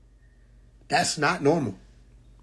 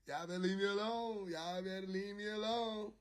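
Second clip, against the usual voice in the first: near-whispered, much softer; very quiet plea; 3 semitones higher, 19 dB quieter than usual. Real, not acted. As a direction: say it under your breath.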